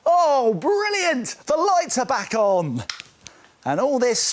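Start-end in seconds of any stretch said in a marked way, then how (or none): 0.0-2.8 delighted. Pretend. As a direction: cheer as the lights return. Lights return.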